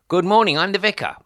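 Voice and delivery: high, loud doorstep introduction; loud greeting. Retold or imitated. Vicar calling to a door that does not open. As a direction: call it through the door. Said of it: "I said".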